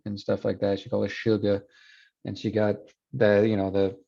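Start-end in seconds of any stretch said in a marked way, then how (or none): 1.1-1.7 put-on sugar said "shuga" in a Southern accent; Southern r-less ending on sugar. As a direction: imitate a Louisiana grandmother's "shuga". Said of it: "her Southern accent would come out".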